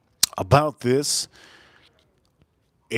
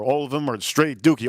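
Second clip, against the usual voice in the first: normal talking voice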